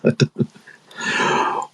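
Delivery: breathless laughing whisper. Real, not acted. Amused at his own accent, laughing whisper.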